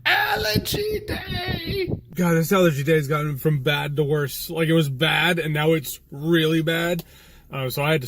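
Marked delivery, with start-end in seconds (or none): 0.0-1.9 slow, high, crying wail; drawn-out wail. Real, not acted. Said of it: "That's why I sound weird". 2.1-7.0 nose plugged with tissue; talking with a blocked nose; tissue up one nostril. Real, not acted. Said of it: "That's why I sound weird".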